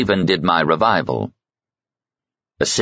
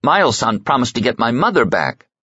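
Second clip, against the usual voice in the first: cried out; 7 semitones higher than usual. A narrator's reading. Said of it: "who cried".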